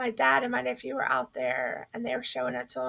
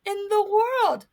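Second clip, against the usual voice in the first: stunned disbelief; 7 semitones higher than usual. Retold or imitated. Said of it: "I thought".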